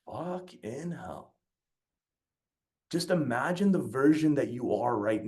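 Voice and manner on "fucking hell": stunned, slow and soft. Retold or imitated. Stunned inner reaction to trainer.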